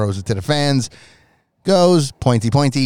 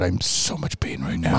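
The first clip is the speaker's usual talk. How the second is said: low, softer faked agony; mock pained groan; 7 semitones lower, 7 dB quieter than usual. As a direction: fake being badly hurt. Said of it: "Like, "Oh my God".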